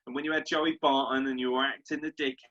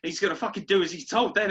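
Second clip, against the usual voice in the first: ordinary talk, nothing marked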